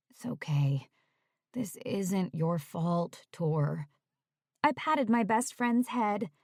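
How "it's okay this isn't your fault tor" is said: reassuring. Acted. Comforting guilty friend.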